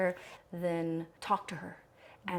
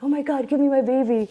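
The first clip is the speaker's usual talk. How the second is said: much higher and louder, gushing; an excited new mother's cry, acted out; mock elated outburst; 8 semitones higher, 13 dB louder than usual. Imagining the reaction she lacked at birth, mock elated outburst. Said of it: "so just like".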